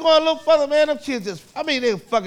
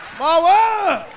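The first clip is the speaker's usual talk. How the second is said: put-on high, loud excited kid shout; kids hollering; 7 semitones higher, 3 dB louder, much slower than usual. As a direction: holler it out like an unruly kid, stretching the last word.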